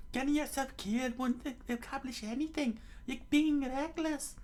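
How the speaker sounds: high falsetto warning; urgent female character voice; worried young woman scolding. Acted. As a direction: plead with him in a put-on high voice. Nami warns the mayor.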